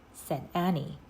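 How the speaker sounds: ordinary delivery, nothing marked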